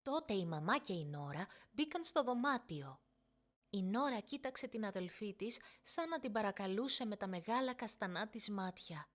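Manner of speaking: ordinary delivery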